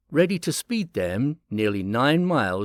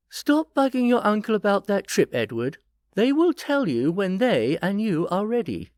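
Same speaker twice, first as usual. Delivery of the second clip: warning; 9 semitones higher than usual. A narrator's reading. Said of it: "Beth warned".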